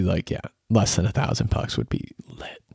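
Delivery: whispered wish; wistful whisper. Real, not acted. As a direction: hush it like a daydream. Wishful aside about rent.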